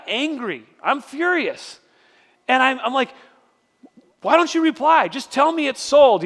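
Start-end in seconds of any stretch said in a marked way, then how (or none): 4.2-6.2 higher-pitched mock exasperation at the seller; retold anger. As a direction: act out an annoyed complaint, pitched up. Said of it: "I'm mad at the guy".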